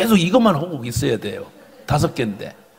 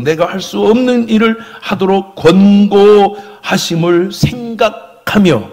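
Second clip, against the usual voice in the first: loud declamatory prayer, slowing at the close; fervent prayer; 3 semitones higher, 5 dB louder than usual. Acted. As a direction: pray it out to a congregation, building. Recites a prayer aloud. (힘주어 읽는 회개 기도)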